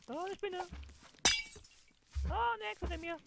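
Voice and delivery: high, panicked cries; being chased. Real, not acted. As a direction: squeal as monsters close in. Monsters chasing him in game.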